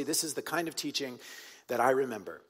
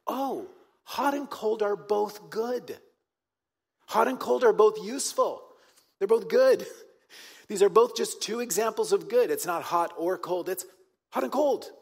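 mock realization; 7 semitones higher, 6 dB louder than usual. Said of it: "we go".

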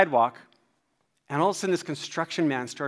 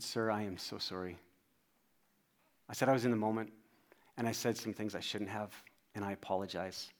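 low, soft, humble admission; sincere apology; 5 semitones lower, 10 dB quieter than usual. Retold or imitated. Dad apologising to the construction man, sincerely.

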